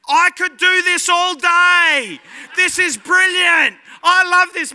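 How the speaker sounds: high, loud, drawn-out cries of delight; exhilarated shouting. Retold or imitated. Rider spinning around beside his terrified wife, gleefully yelling. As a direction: shout it slowly over the ride, elated.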